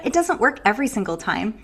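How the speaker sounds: normal talking voice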